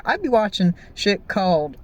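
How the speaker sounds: ordinary talk, nothing marked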